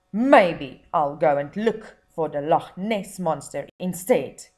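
mildly cross showman giving up. Acted. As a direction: grumble it like a thwarted hunter. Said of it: "Buncombe said crossly".

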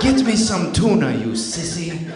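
put-on a disdainful cat in a French accent; hammed-up French cat sneering at its owner. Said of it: "all cats sound French in my head".

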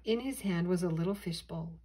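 ordinary delivery, nothing marked

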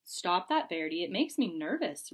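ordinary delivery

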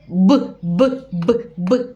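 "б" repeated sharply, like a telling-off; scolding the consonant. Shown as a demonstration. Teacher shows parents how the children scold consonants. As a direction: tap out "б" as if scolding. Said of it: "Приголосні ми ругаємо".

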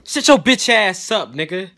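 angry snap. Acted. Sudden snapping outburst.

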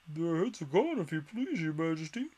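a hint of a soldier answering the queen; soldier shouting. A narrator's reading. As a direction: shout back dutifully. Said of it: "the soldier shouted in reply".

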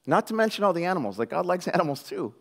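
ordinary talk, nothing marked

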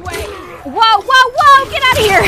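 loud high panicked yelling; panicked shout. Real, not acted. Gamer yells at monsters to get away, panicked shout. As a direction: scream at the monsters to back off.

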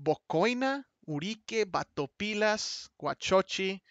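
Bocoina, Urique, Batopilas, Guachochi in careful Spanish sounds; Spanish pronunciation. Shown as a demonstration. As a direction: read out the Mexican names softly, one by one. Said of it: "pronounce these names".

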